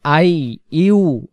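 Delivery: আই ইউ with the ইয়া doubled, not আই উকুম; ayyu doubled. Shown as a demonstration. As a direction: double the y in আইয়ুকুম. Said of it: "আই উকুম না". (আইয়ুকুম, আই উকুম নয়)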